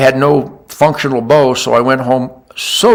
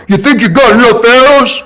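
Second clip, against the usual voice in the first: mocking skeptic, higher and louder; mocking people; 8 semitones higher, 4 dB louder than usual. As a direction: sneer at the bow hunter's ten arrows. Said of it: "They go".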